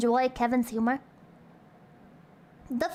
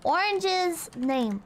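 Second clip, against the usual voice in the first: ordinary talk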